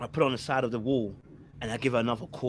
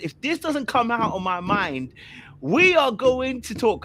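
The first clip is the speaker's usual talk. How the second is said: building frantic; 8 semitones higher, slower than usual. Retold or imitated. Frantic self-talk, rising.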